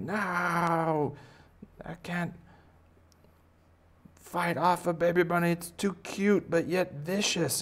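high-pitched mock despair; helpless victim. Defender unable to fight a cute bunny, high wail. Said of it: "And he's like".

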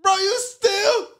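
very high, slow, stretched-out bro; high squeaky disbelief. Retold or imitated. Telling his friend about the cold plunge, squeaky high disbelief. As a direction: squeak it out in mock shock. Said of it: "I'm like".

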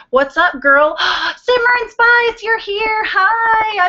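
high, bright, thrilled hello; excited greeting. Real, not acted. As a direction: gasp and greet a friend with delight. Greeting a viewer who joined.

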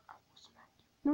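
breathy hushed threat; whispered threat. Real, not acted. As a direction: hiss the threat under the breath.

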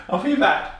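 ordinary talk

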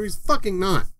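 normal talking voice

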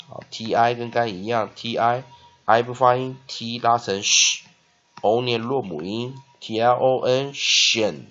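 usual speaking voice

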